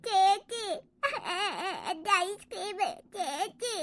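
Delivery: sobbing softly between words; crying child. Acted. Little boy whose ice cream was taken, crying. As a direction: wail weakly for big sister. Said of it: "നീ കിടന്ന് കരഞ്ഞിട്ടേ".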